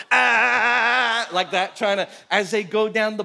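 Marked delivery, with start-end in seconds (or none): 0.1-1.3 long, high, loud "aah"; drawn-out yell to throw off a bowler; mock distracting yell. Albie yelling beside the other bowlers, mock yell. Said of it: "he's going next to him going".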